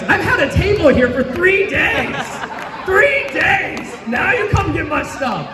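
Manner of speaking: high, laughing mock complaint; laughing exasperation. Real, not acted. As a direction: tease the crowd through laughter.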